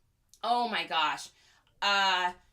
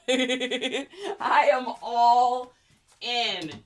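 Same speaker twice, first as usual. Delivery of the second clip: laughing; 6 dB louder, much slower than usual. Real, not acted. Drawn out laughing.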